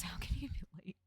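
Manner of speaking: whispered with a laugh. Real, not acted. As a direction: breathe it through a giggle. Whispered laughing read of option.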